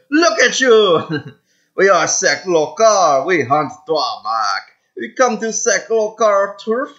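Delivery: loud, boastful alien in a put-on Mediterranean accent; mock Mediterranean-Caribbean alien accent. Alien raider bragging to a rival, mock Mediterranean accent. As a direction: crow and taunt in a loose Caribbean-tinged accent. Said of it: "why I gave him that accent".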